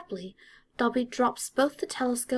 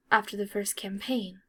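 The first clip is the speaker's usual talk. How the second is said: ordinary delivery, nothing marked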